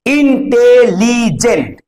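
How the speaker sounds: "Intelligent" slowly as ইন, টে, লি, gent; split into syllables. Shown as a demonstration. Teacher counting four syllables, slow and divided. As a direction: give each of the four syllables of "intelligent" its own beat. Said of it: "চারটে আছে".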